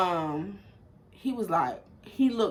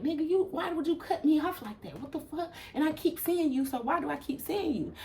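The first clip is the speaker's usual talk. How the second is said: high-pitched heated demand, pitched well up; angry confrontation; 10 semitones higher than usual. Retold or imitated. She rolls down window to confront the driver, high angry challenge. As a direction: snap at him like he crossed a line.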